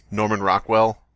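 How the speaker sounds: ordinary talk, nothing marked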